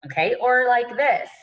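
usual speaking voice

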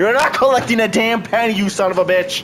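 furious yell at the robot; angry shouting. Real, not acted. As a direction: shout it, livid. Yelling at the tax robot.

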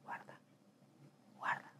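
desperate whispered plea; hushed prayer for help. Retold or imitated. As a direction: pray under your breath.

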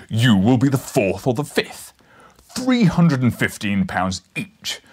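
big booming menacing professor voice; Brian Blessed style Challenger. Acted. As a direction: loom over the intruder, boom each threat. Challenger threatens the journalist.

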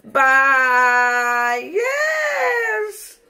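loud, high, crying farewell; real happy tears in a shouted goodbye; overjoyed crying shout; tearful shout. Real, not acted. Emotional sign-off to viewers.

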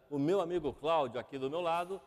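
usual speaking voice

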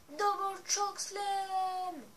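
booming announcer call, stretched out; wrestling commentator. Pretend. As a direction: yell the move name like a ring announcer. Gameplay finisher announced. (commentateur de catch qui hurle le nom de la prise)

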